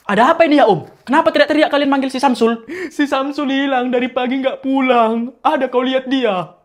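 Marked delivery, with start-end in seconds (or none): none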